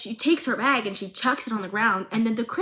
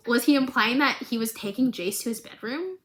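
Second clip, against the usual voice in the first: normal talking voice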